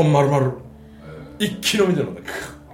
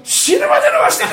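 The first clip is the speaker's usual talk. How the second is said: put-on 1 wild drunken shout; 12 semitones higher, 9 dB louder than usual. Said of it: "言うたんよ".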